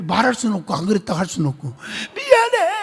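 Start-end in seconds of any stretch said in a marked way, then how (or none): 2.1-2.7 pastor's apology. Retold or imitated. Pastor answering the weeping deacon.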